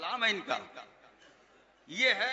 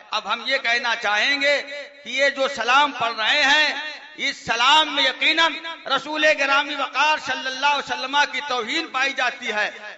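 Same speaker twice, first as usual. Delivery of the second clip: louder, emphatic preaching; raised preaching voice; forceful pulpit declaration; 4 semitones higher, 10 dB louder than usual. Real, not acted. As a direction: press the verdict home to the crowd.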